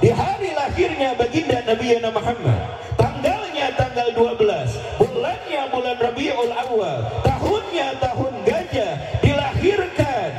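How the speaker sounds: high, fervent sermon voice; full-force preacher declaiming; fiery preaching. Acted. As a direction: preach it out with rising fervor.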